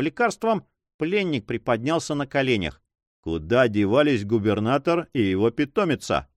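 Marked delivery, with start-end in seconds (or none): none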